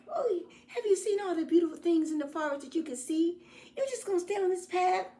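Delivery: high, coaxing wolf voice with a laugh; a high, laughing, persuasive wolf. Acted. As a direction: tempt her off the path, playfully. Wolf tempts girl.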